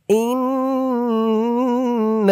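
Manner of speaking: slow melodic tajweed chant; Quran recitation. Shown as a demonstration. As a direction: draw out every vowel in recitation. Recites verse slowly.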